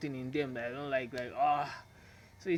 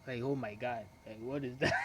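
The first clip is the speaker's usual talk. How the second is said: low, soft awed murmur; quiet wide-eyed admiration; awestruck murmur; 5 semitones lower, 6 dB quieter than usual. Shown as a demonstration. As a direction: breathe it out as if stunned by her looks. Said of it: "this is the way a guy is supposed to act".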